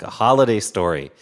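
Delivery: ordinary delivery, nothing marked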